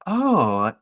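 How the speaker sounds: feigned pleasantness. Acted. Senator pretending to a man needling her, feigned pleasantness. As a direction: put on a pleasant front. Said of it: "smile and pretend like".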